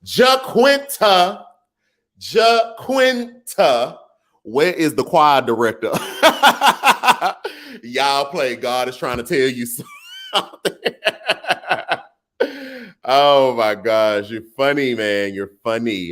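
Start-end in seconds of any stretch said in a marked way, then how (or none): 0.0-4.0 drawn-out call. Real, not acted. Calling out a name. 6.0-7.8 very high, delighted laugh; real laugh. Real, not acted. Laughing at the chat's joke, very high and delighted. 10.8-12.0 high, breathy-free chuckle; real laugh. Real, not acted. Laughing at the chat's joke about God, a high laugh.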